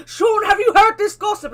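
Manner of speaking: high, loud, eager gossip; bursting to share news; excited gossip. Retold or imitated. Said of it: "I just run off to Sean and be like".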